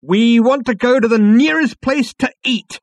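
loud, high, slow command; emphatic order. A narrator's reading. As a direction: spell out the order forcefully. Zaphod orders the computer emphatically.

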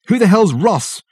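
high exasperated shout back. A narrator's reading. Dirk yelling back at frantic Richard, shouting. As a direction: yell it straight back at him. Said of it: "shouted Dirk back at him".

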